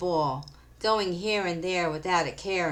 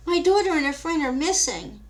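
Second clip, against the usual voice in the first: worried mother; 9 semitones higher, 6 dB louder than usual. Acted. Mother reports missing daughter.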